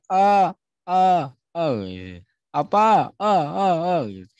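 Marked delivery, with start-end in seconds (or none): 0.1-1.7 loud high ah, fading repeats like an echo; echo shout demo. Shown as a demonstration. Teacher demonstrating echo to students, loud echoing shout. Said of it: "Misalnya saya teriak". 2.5-4.0 loud high call with echoing repeats; echo shout demo. Shown as a demonstration. Teacher showing an echo in class, loud echoing call. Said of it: "Nah, itu disebut gema".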